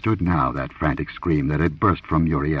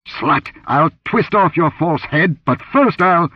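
much higher, louder, slow menacing rage; furious priest; 10 semitones higher, 4 dB louder than usual. Acted. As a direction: threaten her like a striking cobra. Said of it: "his voice hissed like a cobra".

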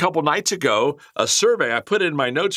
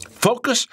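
"Focus" lifted much higher, rising; emphatic word; 10 semitones higher than usual. Real, not acted. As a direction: hit "Focus" high and hold it. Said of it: "listen to me".

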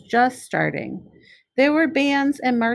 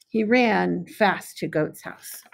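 plain reading voice

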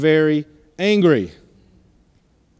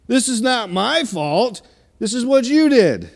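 voicing Cain; 6 semitones higher than usual. Cain voiced blaming God.

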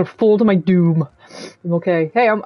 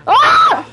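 scream; 6 dB louder than usual. Real, not acted. Spider attacks, scream.

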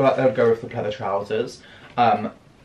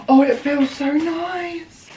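high, slow, drawn-out delight; a high, slow coo of real delight; slower than usual. Real, not acted. Feeling the fabric.

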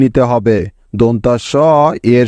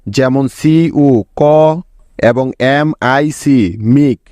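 slow syllables; slower than usual. Shown as a demonstration. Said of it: "দুটি syllable পাচ্ছি".

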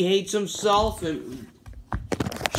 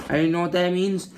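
ordinary talk, nothing marked

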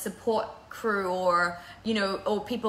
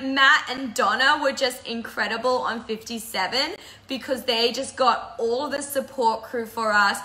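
ordinary talk, nothing marked

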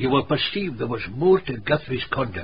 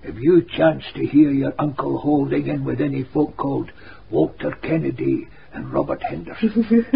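ordinary delivery

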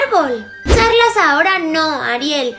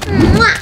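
blown kiss; 3 semitones higher, 6 dB louder than usual. Acted. Blows a kiss to viewers.

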